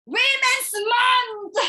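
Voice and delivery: very high, loud, slow declaration; March proclaiming to his siblings; proud announcement. Acted. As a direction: announce it with pride.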